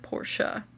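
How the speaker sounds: PORSCHE said as PORSH-uh; two-beat "Porsche", PORSH then UH; two-syllable pronunciation. Shown as a demonstration. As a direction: say "Porsche" with a final UH. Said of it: "I was taught to pronounce it".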